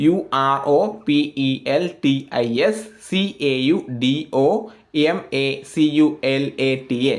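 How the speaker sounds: each letter named separately for learners; spelling it out. Shown as a demonstration. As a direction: read out letter by letter. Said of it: "ഞാൻ spelling എന്ന് പറയാം".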